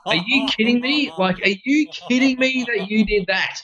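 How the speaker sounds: high-pitched indignant scolding; exasperated disbelief. Retold or imitated. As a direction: re-enact telling off a passenger in disbelief. Retold scolding of his son.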